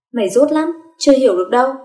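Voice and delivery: exasperated big-sister telling-off; scolding. Acted. Sister scolds after slapping her head.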